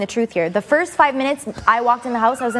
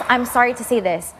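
ordinary talk, nothing marked

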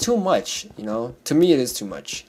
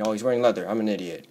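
ordinary talk

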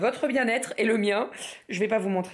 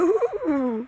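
chilly "ouh ouh"; 7 semitones higher than usual. Real, not acted. Mother feeling the chilly breeze at breakfast, shivery oohs. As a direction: let out high oohs against the chill.

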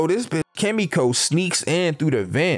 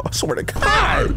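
exasperated; 6 semitones higher, slower than usual. Real, not acted. Exasperated by a passing car.

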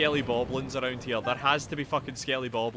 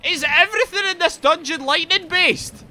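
high, loud outraged question; indignant high-pitched shouting; exasperated yell; 9 dB louder than usual. Real, not acted. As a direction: yell your disbelief at the game.

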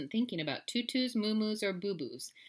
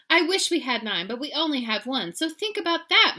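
loud, higher lecture, mildly stern; stern mother; 7 semitones higher, 9 dB louder than usual. A narrator's reading. Mama warns son about nine lives, mildly stern. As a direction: drive the lesson home.

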